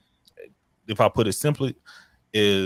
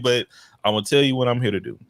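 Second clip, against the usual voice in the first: normal talking voice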